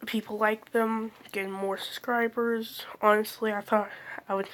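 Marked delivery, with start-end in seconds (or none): none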